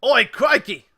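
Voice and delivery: loud, high mock-Cockney yelp; startled cartoon Cockney-Australian shout; attempted Cockney. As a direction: cry out in Cockney slipping into Aussie. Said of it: "I was trying to go Cockney".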